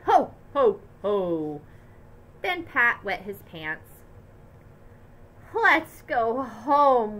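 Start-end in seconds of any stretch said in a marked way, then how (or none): 0.0-1.6 louder hearty ho ho ho; a booming jolly Santa laugh; Santa roaring. A narrator's reading. Santa roaring at the kids in line, louder. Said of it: "Santa roared". 5.5-7.2 louder, very slow plea; crying out. A narrator's reading. Worn-out family crying to go home, louder and slow. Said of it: "we all cried".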